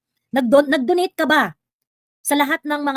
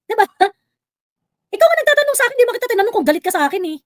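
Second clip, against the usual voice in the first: rushed, raised protest; heated, high; 8 semitones higher, 4 dB louder, faster than usual. Real, not acted. Host scolding the livestream commenter, fast and heated. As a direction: fire it back fast and high.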